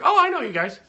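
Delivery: high, loud happy surprise; light up on recognizing them; surprised recognition. Retold or imitated. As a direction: caller realizes they already know the couple. Recognizing friends on phone.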